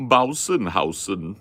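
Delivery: slow, loud mock German; mock German accent. Joking about how it's pronounced in German, mock German accent.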